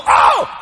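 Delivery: high, sudden frightened yelp; mock scream. Boy fleeing the headless chicken, high mock scream. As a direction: yelp as if chased. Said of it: "I run, I go".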